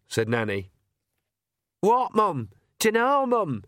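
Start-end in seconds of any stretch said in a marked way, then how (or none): 1.8-3.6 put-on high, flustered young man; Sean's voice. Sean answering his mother, flustered and high. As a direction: answer flustered and a bit loud.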